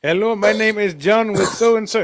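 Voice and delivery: a light mocking take on a call-centre greeting; mock telemarketer. Mocking a telemarketer's phone intro.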